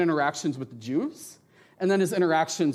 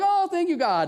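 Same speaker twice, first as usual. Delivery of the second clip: high-pitched, overdone thankfulness; mock gushing relief; 13 semitones higher, 4 dB louder than usual. Preacher mimicking their own thanks to God, mock gushing. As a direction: play up your own gratitude, swooping down from high.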